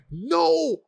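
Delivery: high yelp of no; mock shocked reaction. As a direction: squeal it like a bad actor reacting. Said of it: "He'd be like".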